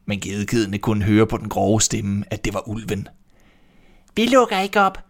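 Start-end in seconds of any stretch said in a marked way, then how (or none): 4.1-5.0 put-on high, loud goat-kid voices; little kids shouting. A narrator's reading. Kids shouting at the wolf through the door, high and loud. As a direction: squeak it out like small kids refusing. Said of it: "råbte de".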